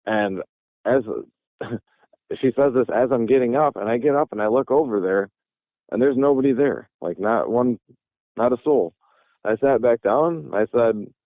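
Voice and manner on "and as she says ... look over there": normal talking voice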